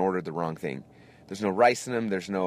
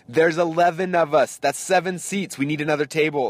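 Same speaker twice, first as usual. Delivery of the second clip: imitating upset wife; 6 semitones higher, 5 dB louder than usual. Wife protests to server about too few seats, upset and high. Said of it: "my wife's kind of upset".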